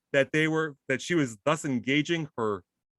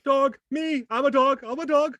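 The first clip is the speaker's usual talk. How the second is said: higher, eager pretend dog; 10 semitones higher, 3 dB louder than usual. Co-host joining in as the dog, a lightly high dog voice. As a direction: play the dog eagerly answering.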